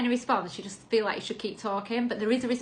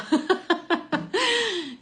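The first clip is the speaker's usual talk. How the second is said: a real, high burst of laughter; 10 semitones higher, 5 dB louder than usual. Real, not acted. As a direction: laugh out loud at yourself. Laughs at her own slip.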